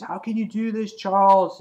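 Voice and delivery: high-pitched protest, loud on the name; hammed-up accusing cry; imitated outraged reproach. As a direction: act out a betrayed comic-book character. Voices Cyclops reproaching Charles in battle.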